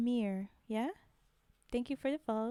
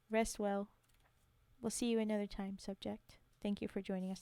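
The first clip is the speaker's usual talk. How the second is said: ordinary talk, nothing marked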